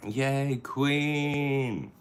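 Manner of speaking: high "Yay, Queen!"; high cheer for a viewer. Real, not acted.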